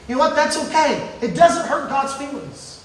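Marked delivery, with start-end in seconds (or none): none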